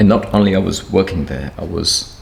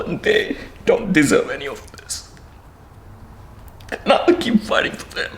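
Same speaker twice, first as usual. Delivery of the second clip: crying; 5 semitones higher than usual. Real, not acted. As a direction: voice breaking high, slipping into whispers. Speaks of wife and kids crying.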